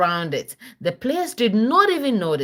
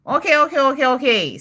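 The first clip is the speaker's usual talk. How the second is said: higher, louder quick okays; a kid happily agreeing; eager okays; 6 semitones higher, 4 dB louder, faster than usual. A narrator's reading. Girl agreeing eagerly with her sisters, higher and louder. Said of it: "says Sierra".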